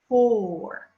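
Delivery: ordinary talk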